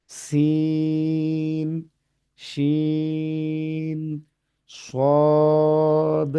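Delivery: each letter name held very long and level; slow recited letter names; three-alif prolongation. Shown as a demonstration. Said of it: "যে হরফগুলো তিন আলিফ টেনে পড়তে হয়".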